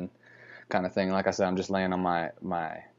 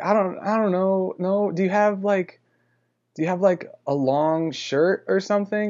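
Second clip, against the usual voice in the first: higher-pitched mock voice for her; imitating hesitant woman; 9 semitones higher than usual. Woman stalls while bargaining over terms, imitated as hesitant and high. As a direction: haggle hesitantly in a lightly put-on higher voice.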